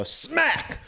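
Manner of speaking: piercing high pretend slap; shouted sound effect. Storyteller shows the slap he only says, high yelp. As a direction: yelp the word in place of a hit. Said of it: "I go".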